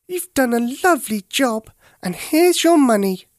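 high, bright-pitched little doll voices; sweet tiny dolls praising. A narrator's reading. Dolls thanking Teddy for cleaning, in high doll voices. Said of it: "said the Doll's House dolls".

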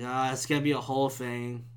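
low, soft groaning complaint; resigned dread. Retold or imitated. Podcaster dreading a police call, low resigned voice. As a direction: say it low and deflated. Dreading the cops being called.

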